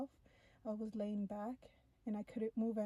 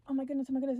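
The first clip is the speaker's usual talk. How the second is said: loud, higher retold panic; freaking out; 4 semitones higher, 9 dB louder, much faster than usual. Narrator to alien after being told to relax, loud panic. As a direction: blurt it out in remembered alarm. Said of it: "I'm still freaking out".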